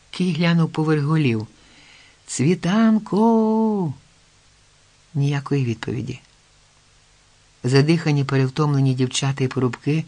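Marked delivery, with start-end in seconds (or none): 2.3-3.9 long, high, drawn-out call; searching hail, held and falling off at the end. A narrator's reading. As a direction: call her name into the distance, stretching the vowels. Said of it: "знову гукнув Кий".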